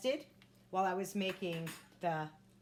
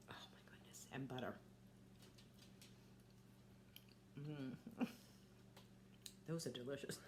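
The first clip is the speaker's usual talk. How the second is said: hushed whisper of pleasure; relaxed; 6 semitones lower, 16 dB quieter than usual. Real, not acted. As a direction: whisper it between bites. Whispering while eating.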